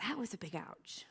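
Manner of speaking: breathy whisper, barely voiced; whispered ouch. Real, not acted. Admitting to the audience it hurt, in a whisper. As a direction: hush it like a private hurt. Confessing a painful moment.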